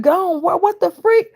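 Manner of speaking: high, loud startled reaction; retold cry of shock; shocked disbelief. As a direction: blurt it out, stunned and high-pitched. Shocked reply to the news.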